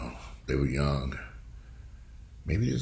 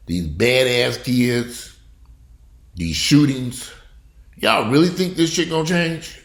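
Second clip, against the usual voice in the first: slow, louder, rising disbelief; incredulous rant; 6 semitones higher, 3 dB louder, slower than usual. Real, not acted. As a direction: hammer each item, then scoff. Incredulous rant about violence.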